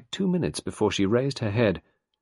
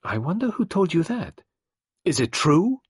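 higher, lightly voiced curious detective; a hint of the detective's curiosity; 11 semitones higher, 6 dB louder than usual. A narrator's reading. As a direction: wonder aloud, intrigued. Poirot curious.

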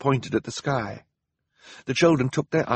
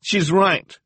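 high, raised voice; alarmed cry; 10 semitones higher, 5 dB louder than usual. A narrator's reading. Klaus to the others as the mob closes in, crying out. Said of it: "Klaus cried".

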